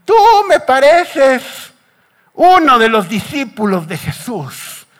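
put-on high, drawn-out old lady's accusation; loud, suspicious little old lady, played up; accusing old woman. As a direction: slowly stretch the first words up high, then let them drop. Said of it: "una viejita, y le dice".

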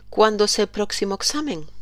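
usual speaking voice

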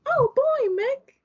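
very high squeaky cartoon mouse; Mickey Mouse voice. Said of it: "that's Mickey Mouse".

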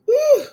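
sudden high, loud "woo"; spontaneous church whoop; praise whoop. Real, not acted. Praise outburst during prayer.

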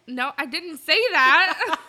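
loud, slow, laughing; laughing protest. Real, not acted.

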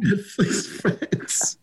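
laughing. Real, not acted. Laughing while repeating partner's line.

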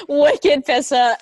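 slow, laughing Boston slang; amused Boston local; Boston accent. Shown as a demonstration. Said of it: "The way you said it's so good".

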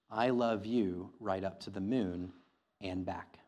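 ordinary talk, nothing marked

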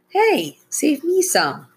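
put-on high, pleading little-girl voice; begging. A narrator's reading. Girl begs the boys for cereal, high pleading. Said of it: "she begged".

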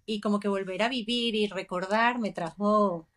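usual speaking voice

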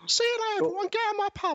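very high pub landlady voice; Barbara Windsor. Retold or imitated. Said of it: "I thought that was Barbara Windsor".

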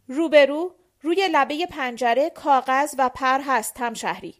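forceful official pointing the way; officious guard. A narrator's reading. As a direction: send them off with a loud order. Guard curtly directs them to window.

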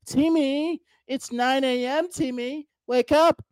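high, sing-song nagging parent voice; imaginary caretaker coaxing Timmy awake, high and sing-song; mock wake-up call. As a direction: call him up out of bed like a fussing mom. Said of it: "No one is gonna show up and tell you".

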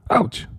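a high yelp of pain, cut short; a sharp little cry from a pillow hit; sudden ouch. Acted. A hidden elf yelps when the pillow strikes him.

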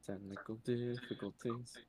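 like your own voice bouncing back; echo. Real, not acted. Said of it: "I can hear myself echo while wording".

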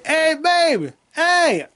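high, lightly voiced old lady call; high mock old woman calling. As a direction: call out sweetly like a grandma. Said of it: "elderly woman ... said".

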